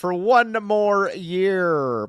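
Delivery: slow, grand declaiming of a famous inscription; hamming it up like a monument plaque; mock-solemn recitation. Parodying Statue of Liberty inscription.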